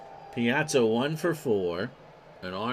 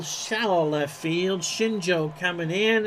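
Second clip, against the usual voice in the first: normal talking voice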